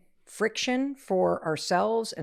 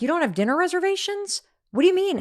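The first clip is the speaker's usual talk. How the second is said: high, louder panicked mom; panicked disbelief; 11 semitones higher, 5 dB louder, faster than usual. Retold or imitated. Mom panicking at the parents about dinner, high and loud. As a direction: shriek it out in disbelief.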